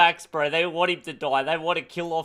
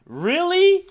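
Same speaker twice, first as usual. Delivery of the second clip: a much higher, louder shocked "Really?"; outraged disbelief; 11 semitones higher, 7 dB louder than usual. Acted. Reacting to Les's Nazi comparison, loud shocked disbelief. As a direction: squawk it in disbelief.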